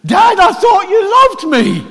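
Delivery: put-on high, slow, loud child's cry to his father; a betrayed son crying out, drawn out and high; Isaac pleading. Isaac cries to his father under the knife, high and pleading. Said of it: "Imagine Isaac looking up and".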